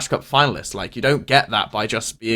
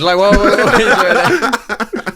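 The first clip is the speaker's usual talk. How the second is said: high, slow laughing taunt; 8 semitones higher, 6 dB louder, much slower than usual. Pretend. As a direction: drag it out through laughter.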